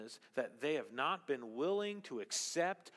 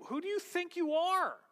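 much higher and louder, a scornful "who do you think you are"; indignant challenge; 7 dB louder than usual. Retold or imitated. The crowd challenging Jesus, indignant and scornful. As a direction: throw the challenge back at him, offended. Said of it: "they're saying something like this".

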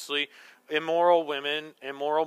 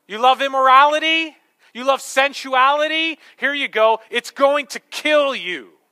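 higher and louder, hammering each question; fiery preaching; 8 semitones higher, 9 dB louder than usual. Acted. Preacher confronting lovers of sin, fiery higher loud preaching. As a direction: preach it like a challenge to the room.

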